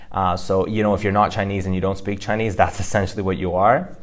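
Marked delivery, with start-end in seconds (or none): none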